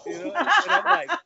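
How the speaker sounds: high, loud laughing through the words. Real, not acted. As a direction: laugh it out, can barely talk. Laughing at own story.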